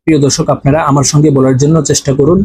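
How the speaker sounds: ordinary talk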